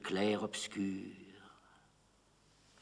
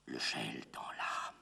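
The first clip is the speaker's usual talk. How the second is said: low, soft, breath-only voice; chilled whisper; 13 semitones lower, 10 dB quieter than usual. Acted. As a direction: drop suddenly to an icy whisper. Chilled drop after the outburst. (chuchotement grave et glacé)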